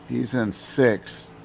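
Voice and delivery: ordinary talk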